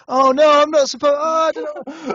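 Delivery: high, loud flustered protest; comically overwhelmed, voice shooting way up; mock panic. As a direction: squeal it out in hammed-up panic. Acting out his own panic at ordering.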